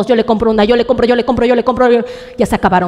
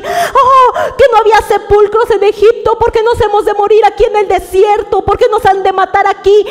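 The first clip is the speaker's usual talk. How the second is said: panicked crying; 7 semitones higher than usual. Acted. Israelites panicking as Egyptians approach, high crying wail. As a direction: cry out in terror of dying in the desert. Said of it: "empezó el pánico".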